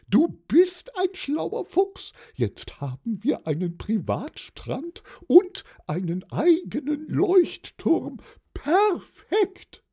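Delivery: very high, gushing wife voice; delighted wife. A narrator's reading. Wife celebrating their new lighthouse, very high and delighted. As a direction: squeal with delight at your clever husband. Wife praising her husband in story.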